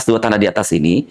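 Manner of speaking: ordinary talk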